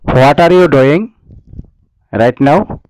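normal talking voice

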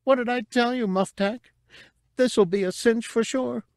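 a high, chirpy little alien voice, cocky; squeaky Cabe, smug and excited; small furry creature. A narrator's reading. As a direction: pitch it well up, pleased with herself. Cabe's voice.

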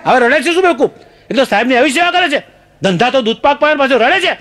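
high-pitched exasperated teacher; angry scolding. Acted. Teacher berating the crying pupil, angry scolding.

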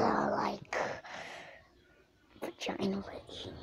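sudden unvoiced whisper. Real, not acted. Gamer whispers a crude joke to viewers. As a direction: drop to a sneaky whisper.

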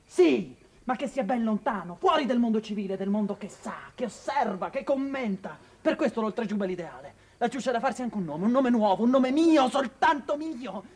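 sempre più alto, fino all'estasi; esaltazione crescente. Acted. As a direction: sali fino a perdere il controllo. (esaltazione crescente)

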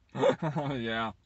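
usual speaking voice

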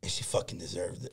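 unvoiced, very soft aside; telling friends about a teacher, whispered; muttered whisper. Real, not acted. Hushed aside.

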